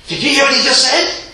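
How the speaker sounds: high, loud mocking glee of the critics; gloating critics. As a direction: crow it like you've caught him out. Critics gloating, acted quote.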